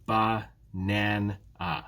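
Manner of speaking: "ba-nan-a" slowly in three separate beats; syllable by syllable. Shown as a demonstration. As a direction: break "ba-nan-a" apart at each syllable. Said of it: "there are three syllables here".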